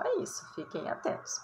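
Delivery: breathy whisper of a tip; whispered aside. Real, not acted. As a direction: lean in and whisper the warning.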